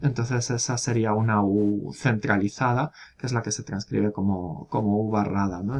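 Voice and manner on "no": ordinary talk, nothing marked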